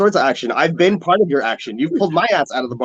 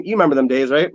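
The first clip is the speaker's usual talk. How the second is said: ordinary talk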